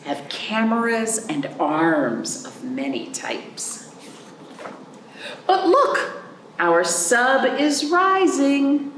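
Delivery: put-on gentle read-aloud for kids; storybook reading. Teacher reading a picture book to children, gentle storybook voice. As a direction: read the picture book slowly to little ones.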